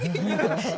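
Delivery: loud burst of laughter. Real, not acted. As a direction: crack up. Laughs after quote.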